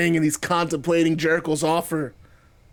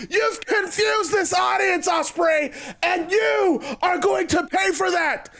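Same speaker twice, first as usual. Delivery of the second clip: high, indignant wrestler threat; Jericho, threatening; 11 semitones higher than usual. Acted. Wrestler warns rival he will pay, high and indignant. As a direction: promise payback in a high, outraged voice.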